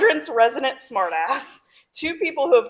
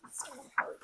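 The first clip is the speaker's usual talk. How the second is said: breathy whisper; 14 dB quieter, faster than usual. Real, not acted. Reacting to quote.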